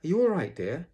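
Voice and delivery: high, loud worried call; Kanga calling anxiously. A narrator's reading. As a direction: call out in motherly alarm. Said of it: "called Kanga anxiously".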